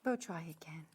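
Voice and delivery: hushed whisper. Real, not acted. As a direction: breathe it as a whisper. Quiet aside while retrying.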